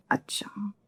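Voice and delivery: unvoiced, breathy अच्छा; in-law mutters a whispered okay. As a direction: drop to a bare whisper on अच्छा. Family member answers, whispered reply.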